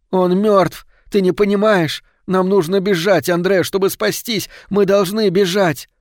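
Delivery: high, loud, urgent outburst; desperate shouting. A narrator's reading. Mother screaming at her son to flee, desperate shout. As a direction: shout insistently, pitch high throughout. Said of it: "и прокричала".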